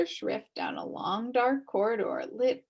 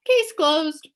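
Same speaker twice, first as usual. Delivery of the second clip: booming judge voice, loud; a judge declaring case closed; stern pronouncement; 8 semitones higher, 9 dB louder than usual. Acted. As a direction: bang it out like a verdict.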